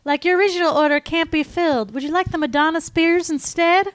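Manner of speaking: high, put-on Southern accent. Friend prank calling as a bra company, in a high Southern accent. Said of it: "like, a Southern accent".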